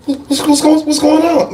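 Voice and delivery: a mom hurrying over, mildly put-on; mimicking the mother. Mother arriving, voiced again.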